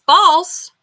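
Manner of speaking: sharp, loud burst of triumph; triumphant shout. Real, not acted. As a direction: blurt the answer out, high and loud. Quiz answer blurted out.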